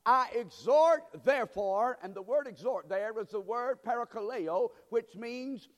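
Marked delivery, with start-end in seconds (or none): 0.0-2.0 loud, raised preaching voice; forceful scripture reading. Real, not acted. Preacher reads Paul's exhortation to the congregation, loud and emphatic. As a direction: proclaim the verse at full force.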